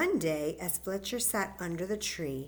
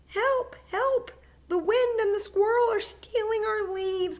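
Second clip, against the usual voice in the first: much higher, louder desperate cry for help; frantic cry; 14 semitones higher, 8 dB louder than usual. Acted. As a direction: scream for help as leaves are stolen. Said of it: "cried a frantic Fletcher".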